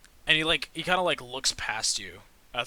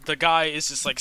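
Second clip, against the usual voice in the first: normal talking voice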